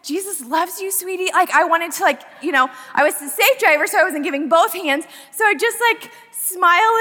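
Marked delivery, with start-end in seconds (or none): 0.0-1.3 higher, softer, sugary sweet; sweetly kind. Retold or imitated. Driver blessing the angry woman, sugary sweet. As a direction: smother her with kindness. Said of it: "in my heart, I'm just like".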